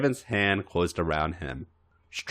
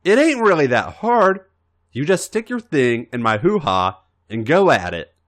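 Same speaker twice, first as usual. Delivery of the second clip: blurting; 6 semitones higher, 5 dB louder than usual. Acted. AJ blurting, embarrassed.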